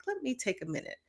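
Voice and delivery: a quiet, steady word to yourself; calm self-talk. Retold or imitated. Showing her partner how she now calms herself, softly.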